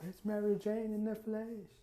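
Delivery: high, slow starstruck gasp of delight; starstruck gush. Acted. Lucy meeting Mary Jane, high starstruck gush. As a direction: savor each word like meeting a hero.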